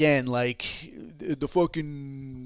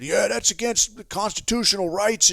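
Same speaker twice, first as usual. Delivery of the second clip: higher-pitched caricature of a complainer; put-on protesting voice, pitched up; mock indignant prepper; 8 semitones higher, 4 dB louder than usual. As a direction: parody a riled-up rights crusader. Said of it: "they're talking about".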